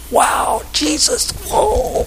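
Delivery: incredulous. Retold or imitated. Pastor stunned as the pilot walks forward, rising amazement. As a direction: let the amazement climb on each word. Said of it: "I'm incredulous".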